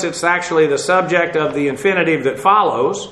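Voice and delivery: ordinary delivery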